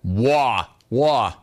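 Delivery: drawn-out New York vowel, aping the caller; mock New York accent. Host mimicking the caller's word "war", mock New York accent. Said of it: "Bro, are you from New York?".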